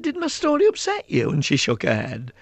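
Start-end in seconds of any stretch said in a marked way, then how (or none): 0.0-1.3 gentle concern. Retold or imitated. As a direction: coax a crying little girl. He gently asks a crying child.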